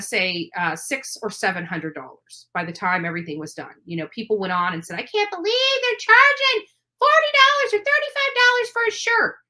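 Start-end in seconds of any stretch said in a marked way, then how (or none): 5.0-9.4 high, squeaky mock of the haters; mocking whiny voice. Mocking the haters who complained about the shirt price, in a high whiny voice. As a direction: parrot the complainers in a silly high voice.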